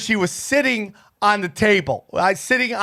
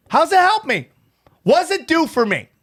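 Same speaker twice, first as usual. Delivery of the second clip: retold exasperated; 8 semitones higher than usual. Arguing back at Sarah.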